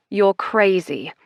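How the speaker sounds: ordinary delivery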